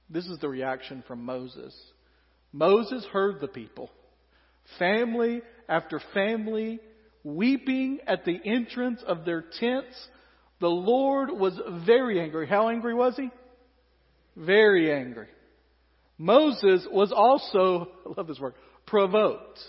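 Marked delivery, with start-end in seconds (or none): none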